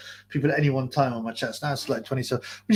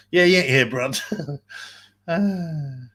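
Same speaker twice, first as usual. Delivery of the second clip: ordinary delivery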